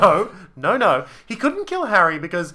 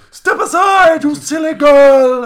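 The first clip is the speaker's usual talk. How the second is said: much higher, louder, slow mock villain shout; hammed-up Voldemort shout; 8 semitones higher, 10 dB louder, slower than usual. Voldemort ordering Lily aside, hammed-up villain shout. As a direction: bellow like a campy villain. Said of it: "he goes".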